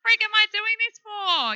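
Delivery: exasperated self. Retold or imitated. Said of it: "I just had a big cry".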